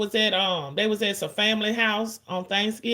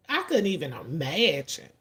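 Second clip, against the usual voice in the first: ordinary delivery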